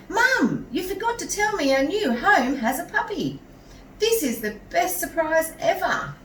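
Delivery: put-on high, loud, thrilled child voice; little boy shouting to his mum about the puppy; excited boy. A narrator's reading. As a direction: squeal it like a boy who just got a puppy.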